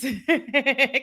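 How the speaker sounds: a short, surprised burst of laughter; laugh, touched by the comment; delighted little laugh; happy laugh. Real, not acted. Moved by a viewer's comment.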